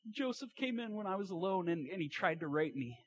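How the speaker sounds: high-pitched fake sobbing, gradually coming down; mock crying. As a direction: wail it out in a hammed-up tearful voice. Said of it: "she's all crying".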